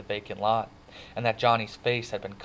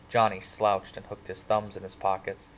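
ordinary delivery

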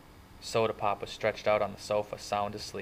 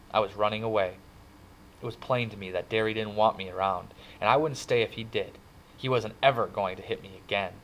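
usual speaking voice

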